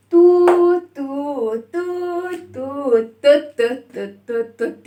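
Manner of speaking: long toots, then short chugging toots; two-note train toots breaking into quick short ones; train whistle imitation. Shown as a demonstration. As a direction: hoot like a train for the children. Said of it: "فاكرين صوت القطار عامل ازاي؟".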